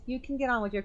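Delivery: ordinary delivery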